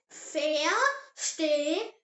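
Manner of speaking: "verstehen" very slow, broken into syllables; slow model pronunciation. Shown as a demonstration. Teacher has learners repeat the German word, slow model pronunciation. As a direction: say "verstehen" slowly, pausing between the syllables. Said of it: "Say it".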